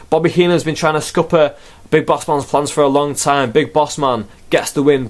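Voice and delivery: ordinary delivery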